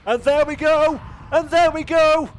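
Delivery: high, loud victory yell; shouting finish call. Real, not acted. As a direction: roar it as the flag drops. Winning car takes flag.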